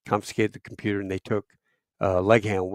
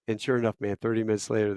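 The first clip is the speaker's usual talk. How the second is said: plain reading voice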